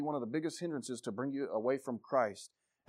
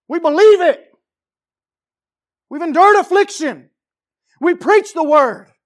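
loud, high rallying sermon cries; full-force preaching; 19 dB louder than usual. Real, not acted. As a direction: build to high, shouted declarations.

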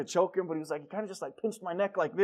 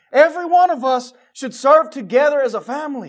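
loud repeat; 5 semitones higher, 11 dB louder than usual. Real, not acted. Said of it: "Oh, it is working".

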